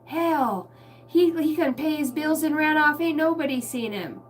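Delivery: put-on high, loud cranky old-timer griping; grumpy old man. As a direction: spit out insults like a sour old grandpa. Continuing the grandpa impression.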